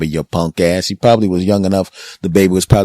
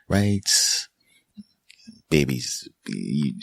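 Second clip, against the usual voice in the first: normal talking voice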